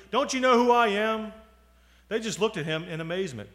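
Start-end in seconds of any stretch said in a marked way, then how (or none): 0.1-1.3 high, loud, affronted demand; cross. A narrator's reading. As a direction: demand recognition in a huff. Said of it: "he said crossly".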